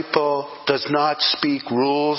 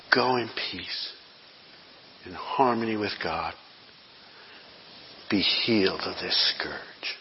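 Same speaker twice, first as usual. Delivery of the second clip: preacher quoting Jesus to the healed woman, tender and hushed; 6 semitones lower, 7 dB quieter than usual. Acted. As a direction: hush it like a gentle promise.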